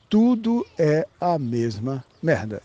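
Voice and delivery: slow, word by word for emphasis; drawn-out, deliberate restatement; slow emphatic repeat. Real, not acted. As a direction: spell it out slowly, weighting each word.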